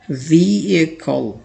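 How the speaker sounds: व्हीकल as "vee-kal" with a silent h, slow; correct pronunciation. Shown as a demonstration. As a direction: model व्हीकल without the h. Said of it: "correct pronunciation होती है". (व्हीकल का सही उच्चारण)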